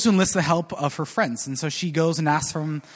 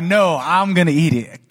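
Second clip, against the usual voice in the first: a touch of smug relish, a bit louder; savage refusal; 5 dB louder than usual. A narrator's reading. Hen teasing the animals with the smell, smug. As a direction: tease them with the bread. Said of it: "Completely savage".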